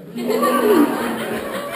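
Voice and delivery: loud high laugh; burst of laughter. Real, not acted. Laughing at own story.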